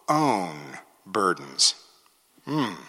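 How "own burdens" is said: slow, much softer, lower own burdens; hushed emphasis. Shown as a demonstration. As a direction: drop low and slow on own.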